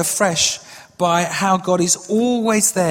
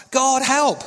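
mild mock cry, pitched higher; retold cry for help; 4 semitones higher than usual. Man on the cliff pleading with God, mild retold cry. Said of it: "Again, he cried out".

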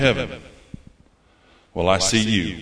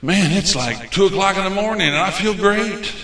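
higher, upbeat mock joy; elated at night; 7 semitones higher than usual. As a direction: sound thrilled to still be awake. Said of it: "be free to be able to say".

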